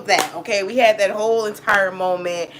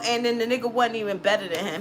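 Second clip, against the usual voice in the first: ordinary talk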